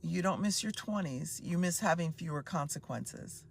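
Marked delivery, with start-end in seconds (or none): none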